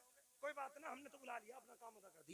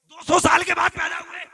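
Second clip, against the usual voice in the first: loud, raised preaching shout; higher, booming emphatic declaration; 6 semitones higher, 27 dB louder than usual. Real, not acted. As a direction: shout it out to the congregation. Preacher hammering home a date.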